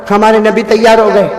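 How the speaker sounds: ordinary delivery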